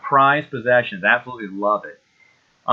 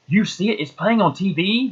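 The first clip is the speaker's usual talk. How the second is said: surprised question; 7 semitones higher than usual. Retold or imitated. Animator's surprised question retold.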